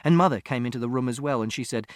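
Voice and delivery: plain reading voice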